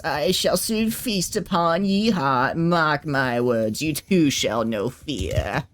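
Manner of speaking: put-on hammy old villain making threats; decrepit enemy voice. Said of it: "read it out in the funny voice".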